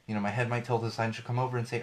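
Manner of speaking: normal talking voice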